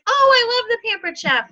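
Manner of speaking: high, gushing customer; excited customer voice. Acted. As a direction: play the delighted customer. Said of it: "and Sherry says".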